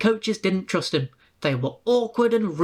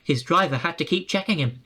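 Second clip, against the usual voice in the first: ordinary delivery, nothing marked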